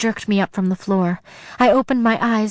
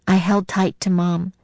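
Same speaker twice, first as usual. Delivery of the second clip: ordinary delivery, nothing marked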